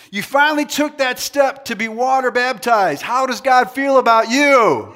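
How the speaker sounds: high, loud hype to the crowd; rousing shout. Real, not acted. Preacher asking crowd about baptism, high rousing shout. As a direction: pump up the crowd for a cheer. Rallying crowd to cheer good news.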